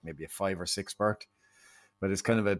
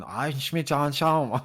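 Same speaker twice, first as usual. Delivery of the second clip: a hint of a bad German accent, rising; 6 semitones higher, 4 dB louder, slower than usual. Friend imitating a stranded German tourist, bad German accent. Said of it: "with his German accent going".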